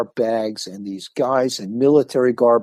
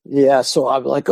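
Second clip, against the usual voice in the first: normal talking voice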